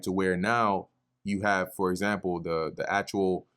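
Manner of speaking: ordinary talk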